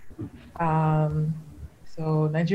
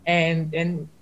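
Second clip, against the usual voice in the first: normal talking voice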